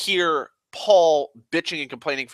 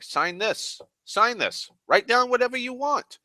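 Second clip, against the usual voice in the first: pitched much higher, pushing harder each time; mock pleading, rising higher; insistent warehouse owner; 12 semitones higher than usual. Vietnamese dude pushing him to sign the blank paper, higher and insistent. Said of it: "he just keeps saying".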